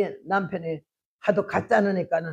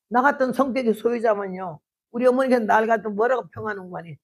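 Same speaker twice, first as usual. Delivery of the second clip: ordinary talk, nothing marked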